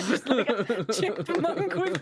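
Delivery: slow, laughing delivery. Real, not acted. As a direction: talk through laughter. Laughing description.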